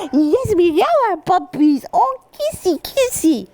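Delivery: very high, excited doting praise; sing-song high puppy talk; high happy voice. Shown as a demonstration. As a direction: gush over the puppies like they're the best. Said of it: "you want them to learn that high, happy voice".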